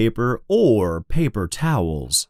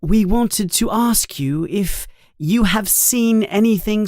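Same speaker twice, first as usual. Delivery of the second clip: high-pitched, careful questioning voice; earnest schoolgirl; 10 semitones higher than usual. Acted. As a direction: ask politely in a high girlish voice. Voices Hermione questioning the ghost.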